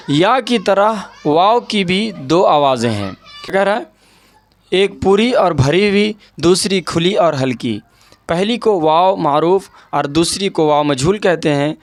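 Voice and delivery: ordinary talk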